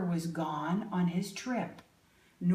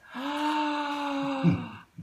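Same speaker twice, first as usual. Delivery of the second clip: long, dramatic mock gasp; 5 semitones higher than usual. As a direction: gasp in pretend shock for the children. Reader shocked at the sign.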